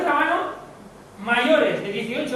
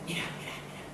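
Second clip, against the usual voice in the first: hushed whispered urging; 5 semitones lower, 15 dB quieter, faster than usual. Retold or imitated. Girl with a phone whispering to the speaker. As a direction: whisper it as if showing a secret.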